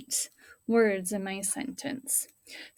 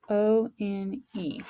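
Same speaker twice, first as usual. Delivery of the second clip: spelling slowly; much slower than usual. Shown as a demonstration. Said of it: "we have to know how to spell".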